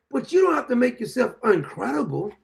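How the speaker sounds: ordinary talk, nothing marked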